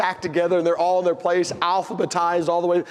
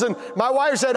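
normal talking voice

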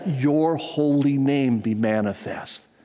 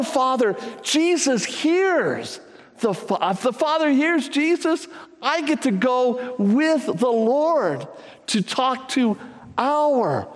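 building sermon; 8 semitones higher, slower than usual. Acted. Preacher telling Sammy and the church Jesus goes with him, building fervour. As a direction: carry the church up with you, slow and strong.

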